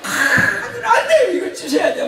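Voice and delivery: high-pitched imitation of a wailing, demanding child; child's tantrum. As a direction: throw a mock toddler tantrum, pitch way up. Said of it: "큰 소리로 애기가 막 땡깡을 쓰고". (땡깡 쓰는 아이 흉내)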